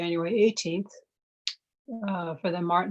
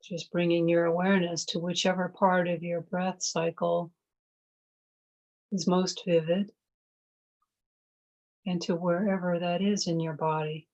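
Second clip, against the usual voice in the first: guided meditation. Real, not acted. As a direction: ease them into noticing the breath. Leads a mindfulness meditation.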